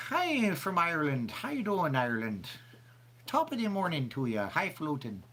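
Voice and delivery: high, hammed-up Irish lilt; bad Irish accent. Host greeting viewer from Ireland, bad Irish accent. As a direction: put on a badly faked Irish brogue. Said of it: "It's my bad Irish accent".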